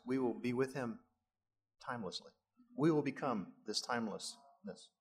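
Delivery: usual speaking voice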